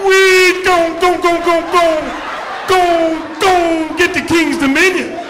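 loud stammer on gon' before King's Dominion; stuttering kid. Retold or imitated. Stuttering kid answering the car trip question, loud stammering gon'. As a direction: get stuck on gon' and push through.

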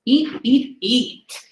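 ordinary delivery

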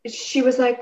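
normal talking voice

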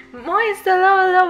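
high, loud hello; excited greeting. Real, not acted. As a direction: welcome a friend with a squeal.

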